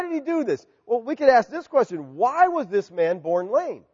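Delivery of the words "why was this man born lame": ordinary delivery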